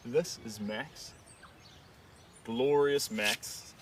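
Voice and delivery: drawn-out, fond naming of the dog; slow loving intro. Real, not acted. Presenting Max the dog to viewers, slow and fond. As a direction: savour each word.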